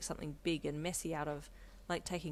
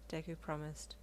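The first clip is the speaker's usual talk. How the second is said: ordinary delivery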